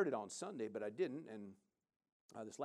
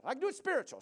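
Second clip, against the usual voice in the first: put-on much higher, louder voice of the woman in her accent; imitating the woman; 11 semitones higher, 6 dB louder, faster than usual. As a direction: lift into her high, earnest voice. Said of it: "she said".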